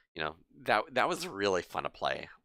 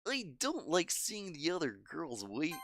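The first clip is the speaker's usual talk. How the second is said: higher, caring schoolgirl voice; gentle girl voice; 10 semitones higher than usual. Acted. Voices Monika's dialogue.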